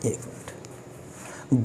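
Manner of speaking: ordinary talk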